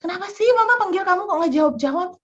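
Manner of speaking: put-on mother scolding child for not answering. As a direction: fire off an annoyed rebuke. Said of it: "saya mulai ngomel nih ya".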